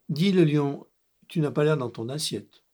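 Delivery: interpelle-le plus fort; voix qui porte vers le lion; appel. Acted. (le narrateur apostrophe le lion, plus fort)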